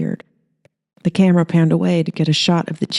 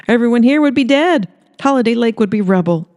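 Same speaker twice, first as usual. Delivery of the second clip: raised, insistent pitch; Holly, emphatic; 7 semitones higher than usual. Acted. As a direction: sell it hard to the camera. Holly on camera praising Earl.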